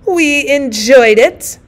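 loud, slow, high insistence; insisting. Acted. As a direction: insist at full voice. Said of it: "insisted the other".

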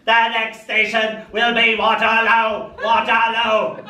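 high, slow, barked Dalek station call; mock Dalek announcer; Dalek announcement. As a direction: drone it like a Dalek on the tannoy.

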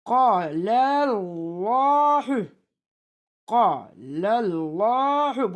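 "Kâlellâhu" chanted slowly with long held vowels; elongated recitation. Shown as a demonstration. Teacher showing the elif stretch, "Kâlellâhu" held long. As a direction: draw out the vowels of "Kâlellâhu", lam heavy. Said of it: "Burada bir elif çekiyoruz".

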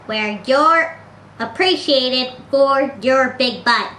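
ordinary talk, nothing marked

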